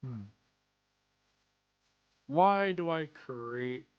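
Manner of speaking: usual speaking voice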